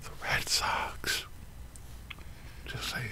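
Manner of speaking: hushed smug whisper. Real, not acted. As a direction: whisper it slyly. Whispered brag.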